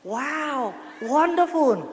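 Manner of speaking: high, admiring English exclamation; impressed American. Retold or imitated. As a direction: gush like an amazed American onlooker. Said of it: "cái tụi Mỹ nó nói".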